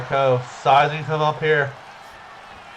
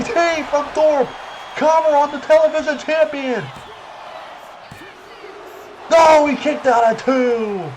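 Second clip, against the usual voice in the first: slow, shouted disbelief; excited shout; 10 semitones higher than usual. Acted. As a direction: yell the kickout like a commentator. Near fall in match.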